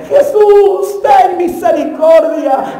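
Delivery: imitated desperate call, stretched slow at the end; Bartimeo shouting. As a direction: act out a beggar crying out for mercy. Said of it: "Bartimeo gritando".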